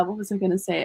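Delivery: normal talking voice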